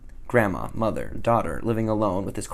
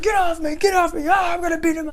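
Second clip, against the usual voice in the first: much higher, louder shouting man; angry shout; 5 dB louder than usual. Retold or imitated. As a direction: yell it while pinned to the ground. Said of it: "and he's like".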